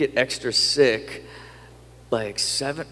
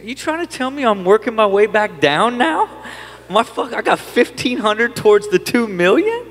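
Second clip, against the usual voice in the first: higher and louder, mock outrage at the tiny payout; hammed-up disbelief, voice climbing; retelling a distributor call, incredulous and much higher and louder; incredulous outburst; 10 semitones higher, 5 dB louder than usual. Incredulous retort about fifteen hundred.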